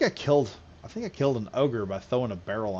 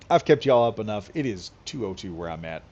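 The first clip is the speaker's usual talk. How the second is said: normal talking voice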